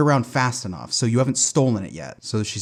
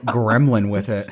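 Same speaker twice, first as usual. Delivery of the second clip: ordinary talk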